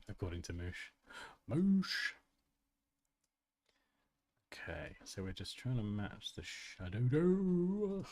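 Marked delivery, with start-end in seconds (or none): 1.5-2.1 MOOSH high and rising; playful echo. Pretend. 6.4-8.1 SHADOW DO with a long rising-then-falling "do"; playful word stretching. Pretend. Playing with the word.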